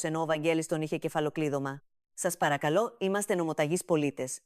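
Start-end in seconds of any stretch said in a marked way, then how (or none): none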